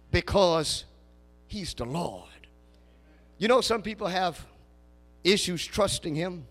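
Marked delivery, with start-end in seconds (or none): none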